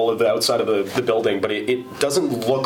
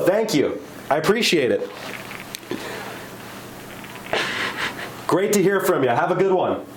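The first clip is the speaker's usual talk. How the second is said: higher, cheery on-air greeting; upbeat radio host; 5 semitones higher than usual. Real, not acted. Host answering the old caller live on air, cheerful higher greeting. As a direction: greet the caller like a bright morning DJ.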